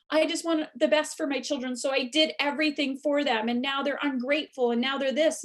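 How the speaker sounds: hammed-up complaint about ungrateful kids; mock aggrieved parent.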